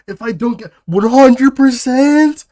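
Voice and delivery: very high, loud, rising yell; outraged shout. Real, not acted. Streamer reacting to a curse on their pulls, outraged shout. Mock outrage at gacha odds.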